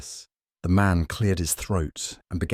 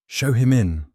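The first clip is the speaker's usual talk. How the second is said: quick, louder, higher command to show the guest in; brisk; 6 semitones higher, 6 dB louder than usual. A narrator's reading. Holmes ordering Mrs Hudson to show the visitor in, brisk and louder. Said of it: "Holmes said briskly".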